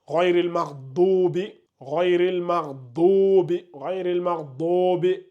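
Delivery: "ghayril maghdubi" drawn out slowly, each sound shaped with care; slow Quran recitation demo. Shown as a demonstration. Teacher slowly demonstrates Fatiha wording to students, careful recitation. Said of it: "dans la sourate Al-Fatiha".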